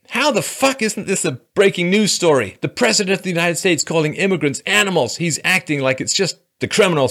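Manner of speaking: high, loud hysterical mock rant; mocking Kathy Griffin. Mocking a celebrity's tweet to viewers, high and shrill. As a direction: shriek it like an outraged celebrity. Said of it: "She said".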